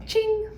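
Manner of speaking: bright high "ching" sound effect; playful ching. Pretend. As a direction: sing out a cheery ching. Playful sound effect after outro.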